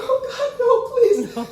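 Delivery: high, urgent plea; re-enacted horrified pleading; alarmed begging. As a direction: beg in dread, pitched high. Begging as possum runs at car.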